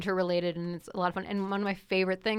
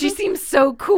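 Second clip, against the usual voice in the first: loud, high, slow starry-eyed gush; gushing fans; 9 semitones higher, 9 dB louder than usual. Retold or imitated. Friend mocks fans gushing about the lioness. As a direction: gush like an admiring fan. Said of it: "all these people are like".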